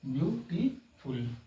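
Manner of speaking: Beautiful slowly in three beats, view, टी, फुल; separated syllables. Shown as a demonstration. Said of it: "one, टू, three". (Beautiful को तीन हिस्सों में धीरे बोलें)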